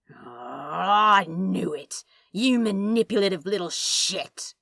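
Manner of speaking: loud accusing burst; Crowley caught him lying, shouting it; triumphant anger. Acted. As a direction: pounce on the admission. Crowley catches angel out.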